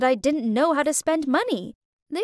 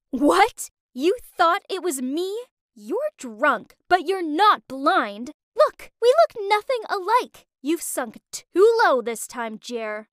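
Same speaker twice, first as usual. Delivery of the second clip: furious; 4 semitones higher, slower than usual. Acted. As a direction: tear into him for his excuse. Naomi confronting cheating boyfriend.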